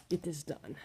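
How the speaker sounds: ordinary talk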